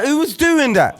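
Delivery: high, incredulous demand; indignant demand. Retold or imitated. Indignant after imitating the scream.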